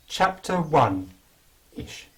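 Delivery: normal talking voice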